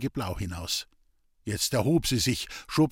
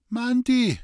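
high, raised urgent call; 11 semitones higher, 4 dB louder than usual. A narrator's reading. Sick man calls after departing friend, raised call. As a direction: shout after someone leaving. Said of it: "rief Hans Peter".

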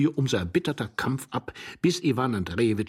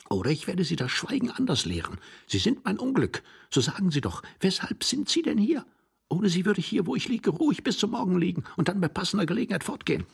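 put-on higher, agitated young man's voice; exasperated young man; 10 semitones higher than usual. A narrator's reading. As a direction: rattle off the complaint, pitched up. Young man threatens his companion.

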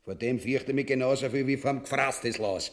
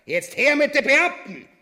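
high, loud command to fetch officials; calling out; 11 semitones higher, 8 dB louder than usual. Acted. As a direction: shout for the officials. King summons officials.

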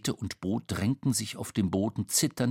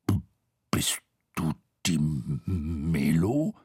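low, halting, timid question; nervous low-voiced stuttering; anxious stutter; 7 semitones lower than usual. A narrator's reading. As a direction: stammer on the first sounds, pitched down. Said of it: "stotterte er und blickte ängstlich".

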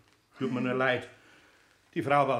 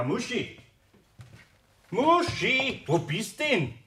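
calling the cat; 8 semitones higher, slower than usual. Acted. Searching room for cat.

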